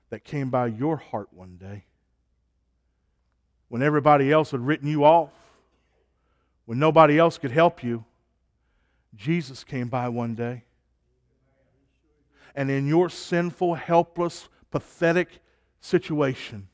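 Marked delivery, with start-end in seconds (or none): 3.7-8.0 loud, fast burst of preaching; impassioned preaching. Real, not acted. Preacher's forceful build in sermon. 12.5-16.7 high, louder, punched-out list; emphatic preaching. Real, not acted. Preacher hammers list of failings.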